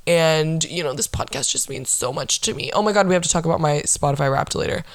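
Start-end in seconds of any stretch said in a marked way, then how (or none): 0.7-2.7 mock sincere. Mock sentimental.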